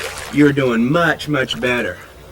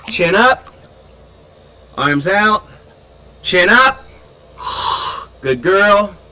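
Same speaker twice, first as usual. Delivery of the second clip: raised voice; 5 semitones higher, 6 dB louder, much slower than usual. Real, not acted. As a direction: project it so she hears with ears submerged. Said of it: "I have to raise my voice so she can hear me".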